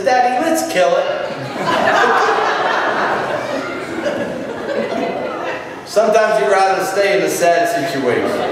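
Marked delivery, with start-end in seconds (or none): 0.0-1.0 put-on higher, eager little boy; bright kid's plea, higher; child's voice. Little boy asks his dad, higher and eager. 1.1-5.8 laugh. Real, not acted. Laughs at own punchline.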